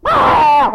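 loud high mock shriek; baby shriek. Baby shrieking at cold rag, loud yelp.

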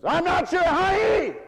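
high, loud snapping shout; shouted retort. Retold or imitated. Retelling a phone call to the florist, shouting back at her. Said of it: "I said on the other end of the phone".

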